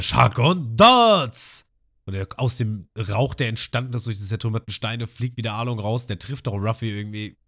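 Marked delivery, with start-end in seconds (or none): 0.0-1.7 long, drawn-out, louder battle cry; attack shout. Retold or imitated. Arlong yells his attack name, long and loud. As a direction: stretch every syllable of the attack name. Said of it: "Und dann hören wir wieder".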